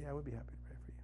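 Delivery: hushed, breathless whisper of reassurance; gentle whisper. Retold or imitated. Pastor whispering to a crying woman, gentle whisper. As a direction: whisper it softly to calm her.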